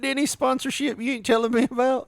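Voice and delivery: mock suspicious question. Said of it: "I was like".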